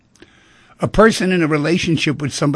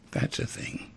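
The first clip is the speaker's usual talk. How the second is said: "That's a thing" repeated in a soft whisper with no voice; "That's a thing" murmured almost silently, as if to himself; 13 dB quieter than usual. Shown as a demonstration.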